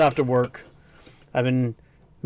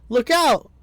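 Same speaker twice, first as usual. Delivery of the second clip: high, louder alarm call; warning shout; 10 semitones higher, 5 dB louder than usual. Acted. Warning the group of danger, a high urgent shout. Voicing a warning line in-game.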